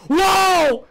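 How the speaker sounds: high, loud yelps; startled and frantic; alarmed screams. Real, not acted. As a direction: cry out in sudden panic. Clown appears again.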